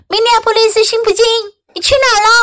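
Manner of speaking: high, quick storybook call; mother's yell. A narrator's reading. Mom demands where the child has been, mock yell. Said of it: "妈妈大喊道".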